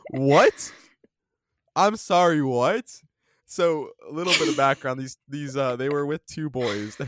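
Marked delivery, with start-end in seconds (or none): 0.1-2.8 loud incredulous what; mock disbelief. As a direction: act stunned and baffled. Reacting to girls' demand.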